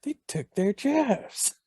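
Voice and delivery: high, slow strangled mock yell; South Park line. Host doing the real South Park line, high slow mock yell. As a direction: hammer the dumb complaint. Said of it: "sounds like".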